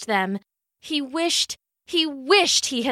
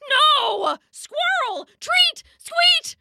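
much higher, frantic outburst; excited yelps; much slower than usual. Acted. Dog sees squirrel grab his treat, frantic yelps.

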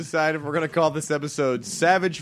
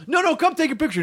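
pushy fan; 10 semitones higher, 3 dB louder, faster than usual. Retold or imitated. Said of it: "he started to get real aggressive".

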